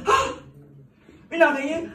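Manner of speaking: high, loud shriek at a spider; exaggerated scream of fear; mock fright. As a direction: panic over nothing, hammed up. Mocking people scared of everything.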